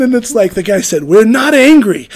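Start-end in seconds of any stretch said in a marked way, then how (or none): 1.0-2.1 imitating a man loudly insisting, amused; slow, loud, high mock protest; raised voice. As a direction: raise your voice in comic denial. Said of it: "he's fucking raising his voice".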